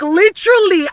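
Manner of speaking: usual speaking voice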